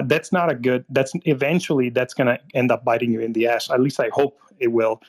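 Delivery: ordinary talk, nothing marked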